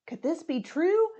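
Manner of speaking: plain reading voice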